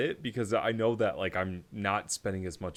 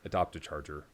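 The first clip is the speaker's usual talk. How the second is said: normal talking voice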